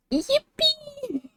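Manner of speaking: very high-pitched little yippee; high cutesy cheer; excited squeal. Acted. Squeals the cheer of cute artwork.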